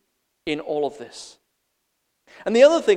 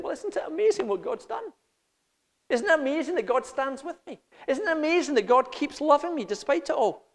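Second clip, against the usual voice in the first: higher, marvelling; amazed; 11 semitones higher than usual. Pretend. Believer inwardly marvelling at God's love, higher. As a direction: wonder at each thought.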